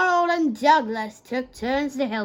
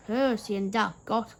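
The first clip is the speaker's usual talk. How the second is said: ordinary delivery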